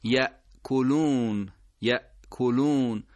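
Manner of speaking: یأکلون slow with a crisp glottal stop; careful recitation; sharp hamza. Shown as a demonstration. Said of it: "به اون تیزی همزه دقت بفرمایید".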